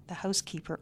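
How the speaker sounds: plain reading voice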